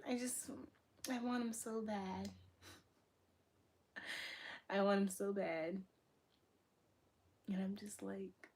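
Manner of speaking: soft, nearly whispered yearning; hushed longing. Real, not acted. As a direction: drop to a hush, pining for a crush. Sighing with longing for crush.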